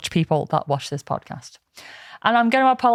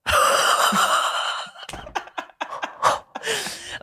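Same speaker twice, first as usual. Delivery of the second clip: high laughter with little voice; long breathless laugh; 12 semitones higher, 4 dB quieter than usual. Real, not acted. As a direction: dissolve into wheezy laughter. Laughing at vertical smile.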